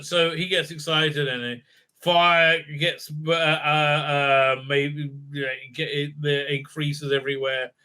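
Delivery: ordinary talk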